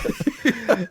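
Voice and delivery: squealing laugh; high laugh. Real, not acted. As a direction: crack up. Laughing at the message.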